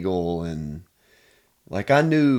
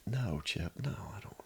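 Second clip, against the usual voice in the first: soft breathy denial, almost under the breath; sheepish near-whisper; 13 dB quieter than usual. Retold or imitated. Man quietly denying to his counselor, sheepish hush. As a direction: shrink back and deny it in a hush.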